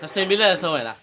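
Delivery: yelled, loud and a bit high; real shout while running. Real, not acted. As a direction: shout across the chase. Yelling during the chase.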